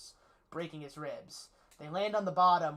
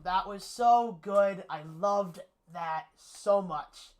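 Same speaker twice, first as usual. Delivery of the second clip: gushing, slow and loud; 4 semitones higher, 9 dB louder, much slower than usual. Real, not acted. Gushing over favorite movie line.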